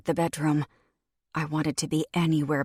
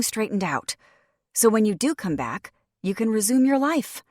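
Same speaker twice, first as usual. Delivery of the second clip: urgent pep talk; 7 semitones higher, 6 dB louder than usual. Acted. Continuing the urgent instructions.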